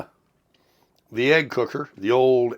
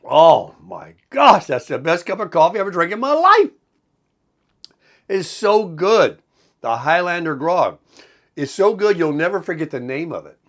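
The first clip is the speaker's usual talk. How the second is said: delighted; 6 semitones higher, 5 dB louder than usual. Real, not acted. Raving about his coffee.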